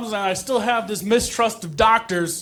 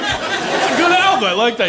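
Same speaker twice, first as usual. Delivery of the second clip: usual speaking voice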